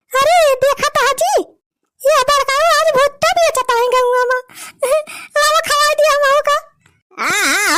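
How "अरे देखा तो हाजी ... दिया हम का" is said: loud village woman. Acted. Woman shouts to another.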